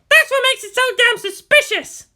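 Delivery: put-on high, loud girl yelling; shouting. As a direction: yell in outrage at a groping hand. Asuka yelling.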